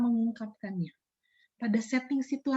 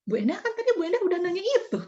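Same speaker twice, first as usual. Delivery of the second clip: surprised protest; 7 semitones higher, faster than usual. Retold or imitated. Interviewee protests repeated question.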